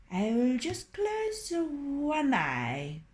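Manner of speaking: a hint of the rooster, slow; rooster's thought. A narrator's reading. Rooster thinking while singing.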